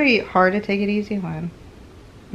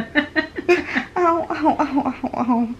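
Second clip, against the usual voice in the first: higher ows broken by laughter; laughing in pain; 8 semitones higher, much slower than usual. Real, not acted. Patient laughing though it hurts her, pained. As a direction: laugh and wince together. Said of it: "It hurts".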